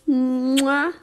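kiss it better with a smack; kiss sound. Real, not acted. Kissing the hurt better.

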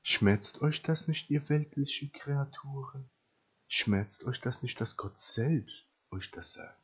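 slow, soft, searching question; quiet preacher's plea after the thunder; hushed appeal. Real, not acted. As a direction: drop the volume and let each word land. Hushed appeal after shouted climax.

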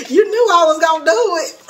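laughing confession. Real, not acted. Admitting her Shein habit to viewers, laughing. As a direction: own up through laughter.

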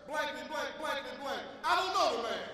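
furious denial, rising to a loud, high I don't know the man; cursing denial. Retold or imitated. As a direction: spit it out like a cornered man cursing. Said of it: "started to curse".

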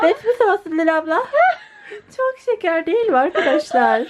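put-on high, giggly baby talk; cutesy laughing voice. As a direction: coo it through giggles. (gülerek tatlı bebek sesi)

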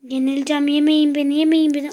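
loud frantic begging; panicked pleading. Real, not acted. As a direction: beg loudly not to be eaten. Fleeing in game, pleading.

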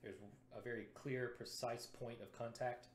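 usual speaking voice

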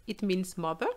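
usual speaking voice

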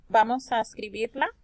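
ordinary talk, nothing marked

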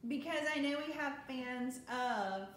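ordinary talk, nothing marked

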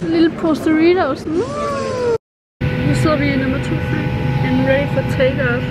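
muffled behind cloth mask; speaking with mouth covered; through a face mask. Real, not acted. Seen 2: Mask covers her mouth to 1.0; then pulled under chin.

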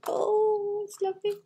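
high gushing coo over the pictures; delighted gush. Real, not acted. Woman showing her childhood book, gushing high with delight. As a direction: swoon over the lovely pictures.

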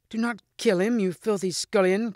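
high, urgent lady's cry; high-pitched scornful command. A narrator's reading. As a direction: cry out high to stop him, full of scorn. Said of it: "cried Lynette".

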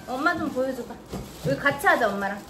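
usual speaking voice

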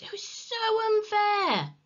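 high, whining complaint; aggrieved wail. A narrator's reading. Henry losing his chance to play, wailing. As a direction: stretch out the injustice.

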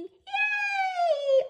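high happy squeal; cheer. Acted. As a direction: squeal with joy for the kids. Cheer after the song.

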